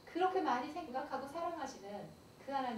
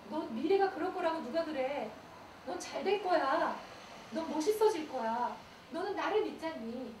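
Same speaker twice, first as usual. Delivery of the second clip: louder, rising encouragement; rousing God voice; 3 semitones higher, 6 dB louder than usual. Retold or imitated. God cheering on a discouraged believer, louder and rising. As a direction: lift them up, God cheering them on. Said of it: "그리고 얘기하시죠".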